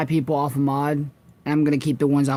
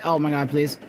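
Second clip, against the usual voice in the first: ordinary talk, nothing marked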